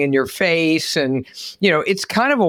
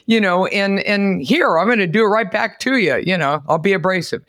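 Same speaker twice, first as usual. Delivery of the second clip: usual speaking voice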